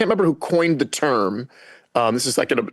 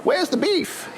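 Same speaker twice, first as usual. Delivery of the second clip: squeaky high goofy catchphrase; silly clown; 13 semitones higher, 3 dB quieter, slower than usual. Shown as a demonstration. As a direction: pitch it way up like a cartoon. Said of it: "I could just do sound effects".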